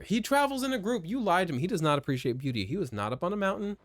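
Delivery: high, rapid outraged rant; mock-outraged accusation; playful indignation. Real, not acted. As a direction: protest the lie in high, quick bursts. Accuses character of lying about horse.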